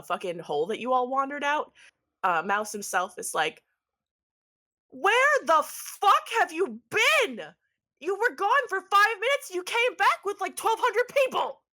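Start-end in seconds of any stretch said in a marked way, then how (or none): 4.9-11.6 exasperated high-pitched outburst; incredulous shouting. Acted. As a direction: shout it high in hammed-up disbelief. Mouse confronts the returning party.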